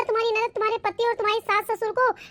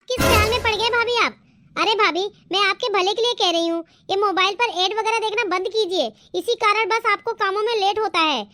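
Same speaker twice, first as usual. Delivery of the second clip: usual speaking voice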